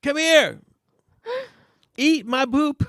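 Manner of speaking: high-pitched pretend tantrum; mock meltdown. Priest melting down at people in the street, mock angry. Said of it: "that's what happens when I melt down".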